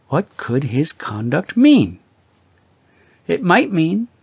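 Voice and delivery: ordinary delivery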